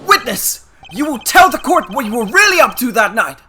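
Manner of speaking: loud, forceful shout; shouting demand. Acted. Lawyer shouting at the witness, loud and forceful. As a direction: bark the order at the witness.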